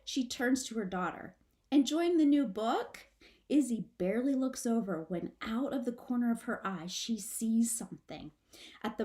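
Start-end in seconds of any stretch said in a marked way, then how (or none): 1.7-3.1 higher, louder cheerful mother; bright mom voice. Acted. Mother chatting with her daughter, bright and higher. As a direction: sound like a breezy mom. Mom's casual question.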